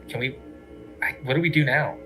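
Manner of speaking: usual speaking voice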